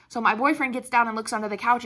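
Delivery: normal talking voice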